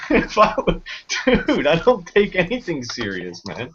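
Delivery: usual speaking voice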